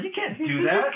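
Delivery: very high-pitched mock objection; mock protest. Retelling an objection to the drops, high mock protest. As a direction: squeal it in playful protest.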